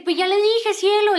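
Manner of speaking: high, loud pleading voice; exasperated husband. Retold or imitated. Man telling cielo he already explained, imitated exasperation. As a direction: imitate a husband protesting to his wife. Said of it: "le decía mucho el señor".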